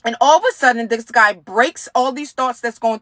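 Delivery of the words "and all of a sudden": ordinary delivery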